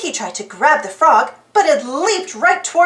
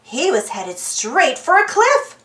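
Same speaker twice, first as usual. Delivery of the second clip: plain reading voice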